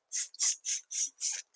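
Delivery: whispered tss of teasing monkeys; monkey tsk. Acted. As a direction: hiss tss back like cheeky monkeys. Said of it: "the monkeys ... said".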